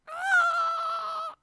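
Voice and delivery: high excited squeal of joy; a real squeal of glee. Real, not acted. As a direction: let out a shriek of pure excitement. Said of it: "'cause I'm so excited".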